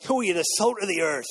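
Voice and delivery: hammed-up pirate voice, lightly done. Shown as a demonstration. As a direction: growl it out like a playful pirate. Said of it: "Say it in a pirate voice".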